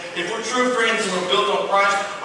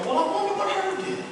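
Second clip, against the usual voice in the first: mock puzzled; 13 semitones higher, 5 dB quieter than usual. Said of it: "you wouldn't go".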